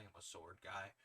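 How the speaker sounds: plain reading voice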